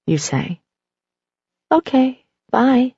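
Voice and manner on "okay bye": small, higher toy voice; little Teddy voice. A narrator's reading. Teddy bear says goodbye to owner in a small higher voice. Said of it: "in a familiar little voice".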